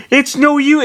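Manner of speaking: loud, very high put-on yell; Roy. A narrator's reading. Swordfighter giving up to the fire, high accented yell. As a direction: shriek it in a silly accent. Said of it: "sounds like it was Roy's dumbass accent".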